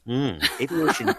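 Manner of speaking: breathy voice settling down; Ryan Reynolds. Acted. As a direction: let the movie-star impression sink. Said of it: "maybe he'll go down".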